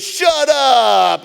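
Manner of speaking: loud, high mock disbelief; incredulous exclamation. Seller hearing of the breakdown, loud incredulous cry. Seller cannot believe it, retold.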